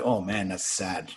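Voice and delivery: low, slow, soft sympathy; mild pity. Retold or imitated. Shipmates pitying the duped sailor, low soft sympathy. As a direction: let it sink with a touch of pity.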